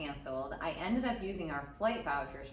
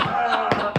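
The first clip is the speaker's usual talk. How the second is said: loud, higher groan of dismay; 5 semitones higher, 14 dB louder than usual. Retold or imitated. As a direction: groan like a caught parent. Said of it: "I'm like".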